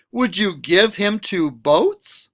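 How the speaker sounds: ordinary delivery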